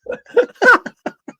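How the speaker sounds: high burst of laughter; embarrassed laugh. Real, not acted. Co-host laughs at his failed impression, high and bursting. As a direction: break up after a failed voice.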